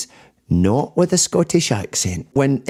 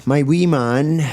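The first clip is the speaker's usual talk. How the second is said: ordinary talk, nothing marked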